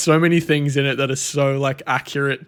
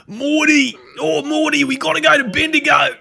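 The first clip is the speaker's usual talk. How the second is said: high, loud Rick voice yelling Morty; high shouted Rick impression; Rick-style shout; 14 semitones higher, 6 dB louder than usual. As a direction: bellow it like a frantic cartoon grandpa. Louder repeat of Rick impression.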